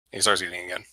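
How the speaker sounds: ordinary delivery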